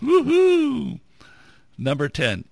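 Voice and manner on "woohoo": high, loud woohoo cheer; excited whoop. Pretend. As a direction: whoop it up like on a ride. Cheer for backyard bungee.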